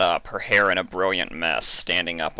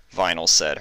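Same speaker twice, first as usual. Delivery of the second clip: ordinary delivery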